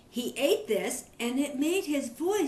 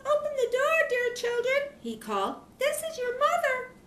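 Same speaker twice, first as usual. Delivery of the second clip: very high, sweet put-on mother voice; wolf faking a mother's sweetness, pitched way up; sweet wolf disguise; 13 semitones higher than usual. Wolf pretending to be the mother goat at the door. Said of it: "The old wolf's voice was soft and sweet".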